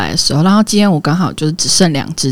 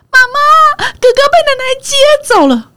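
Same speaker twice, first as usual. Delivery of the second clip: put-on high, loud child voice; little boy announcing; 5 dB louder than usual. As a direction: tell mom big brother is gone. Mother voices younger son reporting.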